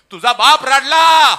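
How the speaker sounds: shouted preaching climax; full-force shout. Acted. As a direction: hurl the line across the hall. Climax of the sermon.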